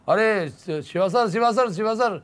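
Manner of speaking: ऊँची आवाज़ में शिवा सर पुकारना; दूर से आवाज़ देकर बुलाओ; पुकारता बॉय. Retold or imitated. (ऊँचे सुर में नाम पुकारना)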